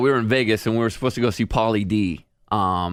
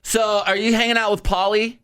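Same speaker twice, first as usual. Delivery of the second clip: high eager question; imitating curious fan; 10 semitones higher than usual. Girl asking [name] about Pauly, high eager. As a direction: fish for gossip excitedly. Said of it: "this girl starts asking me".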